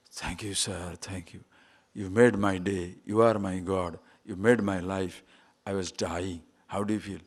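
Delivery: lightly voiced grateful beggar, low and soft; hushed, humble thanks in a beggar's role.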